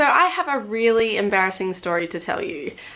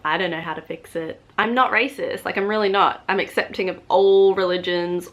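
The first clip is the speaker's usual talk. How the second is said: ordinary talk, nothing marked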